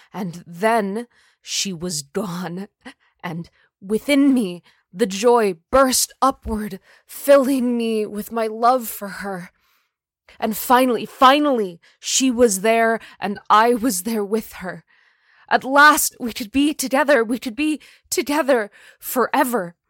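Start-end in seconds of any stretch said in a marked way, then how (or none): 3.2-14.8 loud, swelling rapture; rising joy. A narrator's reading. The stalker narrator exulting as she dies, loud rapture. 15.5-19.8 high, loud, breathless rapture; ecstatic. A narrator's reading. The narrator rejoicing at last, high loud ecstasy.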